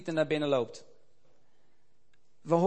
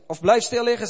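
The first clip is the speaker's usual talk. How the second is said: loud, urgent command to lie still; doctor's firm order; 6 semitones higher, 9 dB louder than usual. A narrator's reading. Doctor orders fainted old missionary to lie still, loud and firm. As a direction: order him to stay down. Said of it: "zei de dokter".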